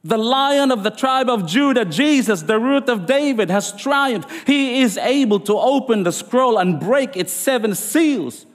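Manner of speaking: lightly voiced elder calling out to John; mild mock yell. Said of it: "yelled out".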